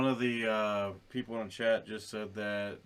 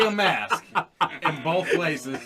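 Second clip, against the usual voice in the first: laughing; 6 semitones higher, 8 dB louder than usual. Real, not acted. Laughing joke.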